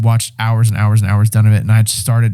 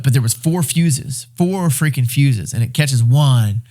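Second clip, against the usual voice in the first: normal talking voice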